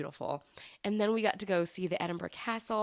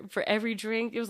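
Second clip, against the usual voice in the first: ordinary delivery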